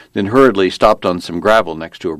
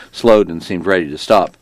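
usual speaking voice

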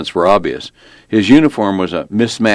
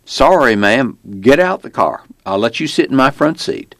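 higher-pitched folksy lawman giving orders; small-town cop; 6 semitones higher than usual. Acted. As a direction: play a matter-of-fact Virginia cop, voice raised in pitch. Narrator plays the small-town cop.

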